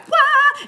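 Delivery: high-pitched held "wah"; imitated energy burst. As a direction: let out a high, sustained burst. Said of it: "my energy is like".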